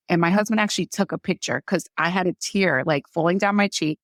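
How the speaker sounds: ordinary talk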